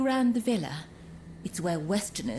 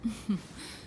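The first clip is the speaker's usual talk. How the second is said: soft laugh; 2 semitones higher, 5 dB quieter than usual. Acted. Amused by the hero's honesty.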